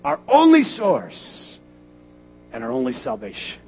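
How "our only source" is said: loud, high, slow preaching; full-force preaching. Acted. Pastor preaching to his congregation, loud and slow. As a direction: thunder the point home to the church.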